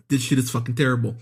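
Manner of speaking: usual speaking voice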